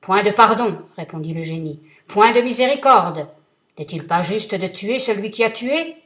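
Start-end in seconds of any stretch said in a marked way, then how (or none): 0.0-0.8 booming, much louder threat; thundering vengeful genie; terrible genie voice. Acted. Genie refuses mercy. 2.1-6.0 booming, much louder threat; thundering vengeful genie; terrible genie voice. Acted. Genie refuses mercy.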